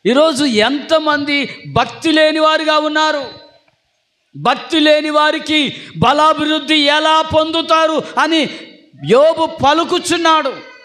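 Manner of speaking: high, slow, fervent preaching. Acted. Pastor preaching Job's words to the church, high and fervent. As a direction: lift the whole church with it.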